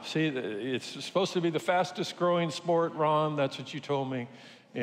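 ordinary delivery

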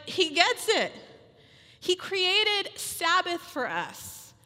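ordinary talk, nothing marked

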